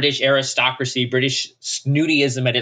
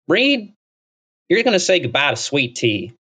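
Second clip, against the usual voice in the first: hokey Texas pickup line; 4 semitones higher than usual. Retold or imitated. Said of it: "I talked to them like".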